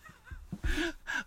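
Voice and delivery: high-pitched real laughter. Real, not acted. Co-host cracking up at the whisper, high laugh.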